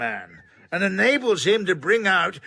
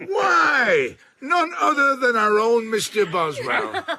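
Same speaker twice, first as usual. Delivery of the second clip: gleeful reveal; 6 semitones higher, 4 dB louder than usual. Acted. Johnson teasing Boswell to the company.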